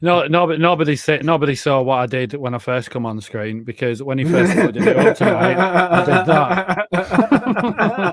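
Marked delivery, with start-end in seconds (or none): 4.3-8.1 drawn-out words through laughter; can barely get it out for laughing. Real, not acted. Laughing about screen prank.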